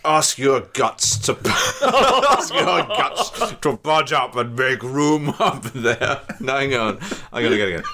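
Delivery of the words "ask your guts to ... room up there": slow Cockney with princely airs; Cockney trying too hard, drawn out. Acted. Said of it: "Sound like a prince".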